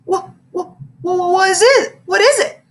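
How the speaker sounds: high, panicky stutter; a scared kid's stuttering panic; frightened stammer. A narrator's reading.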